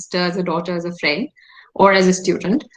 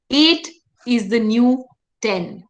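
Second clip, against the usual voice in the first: mantra to repeat; slower than usual. Shown as a demonstration. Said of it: "Can you say that with me?".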